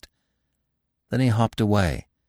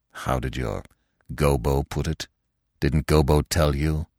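low, understated bitterness; bitter; 5 semitones lower than usual. A narrator's reading. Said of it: "his voice was full of bitterness".

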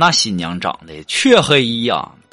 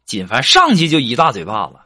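ordinary talk, nothing marked

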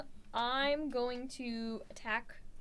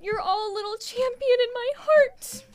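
earnest, swelling to loud and high; tearful proud father pep talk; proud dad speech; 6 semitones higher, 8 dB louder than usual. Acted. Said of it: "single tear, and go".

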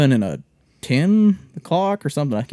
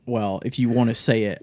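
ordinary talk, nothing marked

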